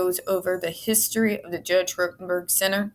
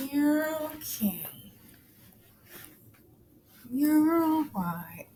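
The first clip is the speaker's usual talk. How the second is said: soft, higher, slow comforting voice, partly whispered; tender whisper to calm a scared kitten; soothing pet; 5 semitones higher, 8 dB quieter, slower than usual. Real, not acted. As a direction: hush a scared kitten gently. Soothing her frightened kitten.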